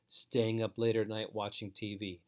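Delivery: normal talking voice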